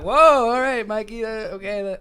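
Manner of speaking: high, loud, taken-aback retelling; mock shock. Group reacting to Mikey's overshare, mock shocked. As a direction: recoil loudly, voice jumping up.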